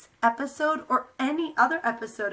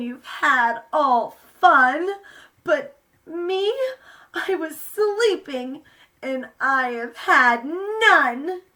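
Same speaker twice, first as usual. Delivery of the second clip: higher, louder whiny bear; grumpy bear; 4 semitones higher, 7 dB louder, slower than usual. Acted. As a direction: complain like a sulky woken bear. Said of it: "he whimpers and he moans".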